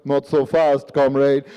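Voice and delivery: low, menacing Russian villain; borscht-drenched Russian accent. Retold or imitated. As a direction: intercept them with a thick Russian accent. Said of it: "a borscht-drenched voice behind you".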